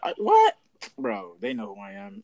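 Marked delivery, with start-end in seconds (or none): 0.0-0.5 incredulous. Real, not acted. Friend says episode isn't sponsored.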